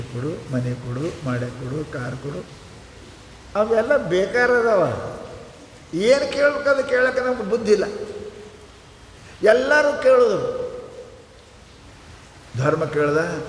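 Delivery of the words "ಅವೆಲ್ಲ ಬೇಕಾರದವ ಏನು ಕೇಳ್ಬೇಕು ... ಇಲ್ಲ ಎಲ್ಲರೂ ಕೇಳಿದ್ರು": forceful preaching. Acted.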